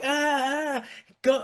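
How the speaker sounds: high, frantic ah ah go; hammed-up panicked shooing; mock panic. Family at the door during the heart attack, mock panic. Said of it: "they're like".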